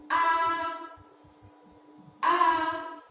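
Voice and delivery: A held high and level; flat first tone. Shown as a demonstration. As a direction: hold A high and steady. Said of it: "Go with me".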